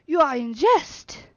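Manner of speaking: slow, indignant butler protest; dignified affront. Acted. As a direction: bristle with dignified offence. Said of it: "in dignified affront".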